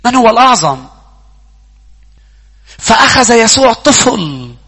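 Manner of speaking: loud, high preaching at full force. Acted. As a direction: preach it out to the whole church.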